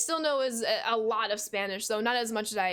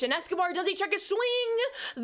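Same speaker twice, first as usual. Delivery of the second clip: excited call; 9 semitones higher than usual. Acted. Tense check-swing moment in play-by-play.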